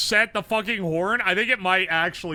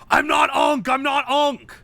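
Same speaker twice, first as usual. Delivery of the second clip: indignant shout; 5 semitones higher, 5 dB louder than usual. Real, not acted. Protesting being called old.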